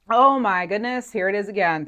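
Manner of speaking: genuinely struck by the sign; amazed. Real, not acted. Sees the repeated number sign.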